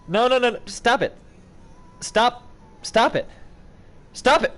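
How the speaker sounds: high, loud pleading shouts; panicked stop-it cries; frantic yelling. Real, not acted. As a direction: yell at it to stop. Puppet going wrong way.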